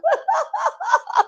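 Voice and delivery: squealing high-pitched giggle; very high laugh. Real, not acted. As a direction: burst into a shrill laugh. Laughs after begging for likes.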